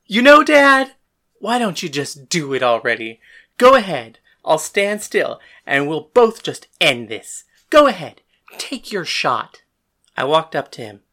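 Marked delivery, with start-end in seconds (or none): 0.1-9.6 loud, defiant dare; angry challenge. Acted. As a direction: dare him to take a swing. Daring his father to strike.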